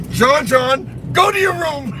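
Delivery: mock strict father. Imitating John John's father ordering him out, stern. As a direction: command the boy like his old man. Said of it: "his father would go".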